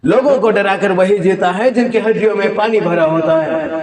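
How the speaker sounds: high-pitched heroic retort; defiant film hero. Acted. Hero scorning the villain's offer, defiant hero voice. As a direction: fire it back high, in one defiant sweep. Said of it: "ये दोनों को mix series भैया।".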